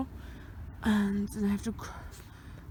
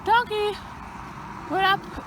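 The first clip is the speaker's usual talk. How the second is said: calling out; 10 semitones higher, slower than usual. Real, not acted. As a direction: call the donkey over, pitched up. Calling out to the donkey.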